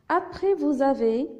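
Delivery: usual speaking voice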